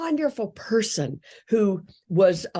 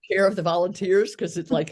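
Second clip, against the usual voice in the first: ordinary talk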